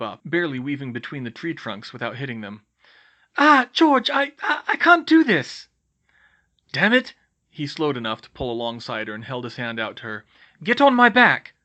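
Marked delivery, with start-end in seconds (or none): 3.4-5.7 high, loud cry of panic; shrill-pitched, overwhelmed protest; panicked cry. Acted. Panicked flight through the trees. 6.7-7.1 loud, high curse; exasperated outburst; frustrated shout. Acted. Frustrated rider in a chase. 10.6-11.5 high, loud command; yelled mid-chase command; urgent shout. Acted. Urgent order during broom chase.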